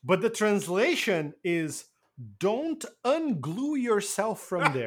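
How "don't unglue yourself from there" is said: higher, slow, drawn-out dubbed line; talking to a daughter. Retold or imitated. Translating the dub, mock fatherly slow line. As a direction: spell it out slowly as if to a child. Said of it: "like he's talking to his, like, daughter".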